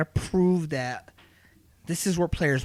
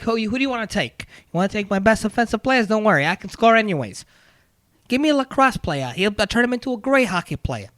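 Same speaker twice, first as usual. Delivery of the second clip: put-on accent, higher-pitched coach quote; Vellucci accent; 4 semitones higher than usual. Host voicing a coach picking players, in a mock accent. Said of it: "why he's got that accent".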